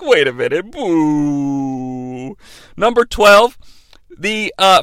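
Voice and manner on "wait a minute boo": low, drawn-out boo; dawning disapproval, pitched low; crowd turning to boo. Retold or imitated. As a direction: sink into a long mock boo. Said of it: "then they're like".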